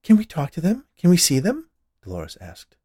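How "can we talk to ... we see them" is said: high, loud eager questions. A narrator's reading. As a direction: ask the medium excitedly. Wife asks medium about spirits.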